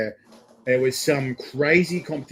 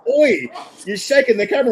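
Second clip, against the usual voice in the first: mock shout; 8 semitones higher, 5 dB louder than usual. Viewer calling out in comments.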